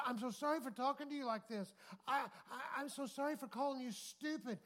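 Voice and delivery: imitated gushing remorse; mock frantic apology. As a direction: play a mortified woman begging pardon. Acting out the woman's frantic apology.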